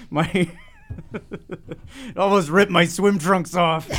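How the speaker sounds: high-pitched, slow telling through laughter; laughing. Acted. Podcast host joking about ripped swim trunks, laughing. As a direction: tell the mishap while cracking up.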